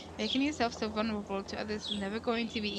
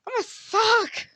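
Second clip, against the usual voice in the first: comic wail; 10 semitones higher, 7 dB louder, much slower than usual. Real, not acted.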